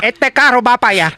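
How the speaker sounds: lightly put-on Puerto Rican accent. Shown as a demonstration. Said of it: "How do you say it in, in Puerto Rican?".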